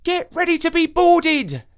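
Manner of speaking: put-on high, loud boy's pirate cry; a boy in a rowing boat shouting at his sister, high and loud. A narrator's reading. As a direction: yell it like a little pirate captain. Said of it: "Jake shouted".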